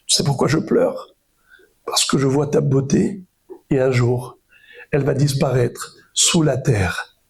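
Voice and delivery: low, subdued voice of sorrow; understated mourning, no sobs; retold grief. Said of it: "Rabbi Elazar se met à pleurer".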